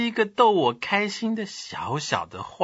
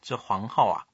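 ordinary talk, nothing marked

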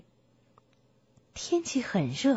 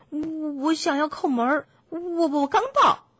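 higher piglet voice, stuttering; stammering piglet; 4 semitones higher, 3 dB louder than usual. Acted. Piglet explains he wants to knock, stammering. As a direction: stumble over the first word of each phrase.

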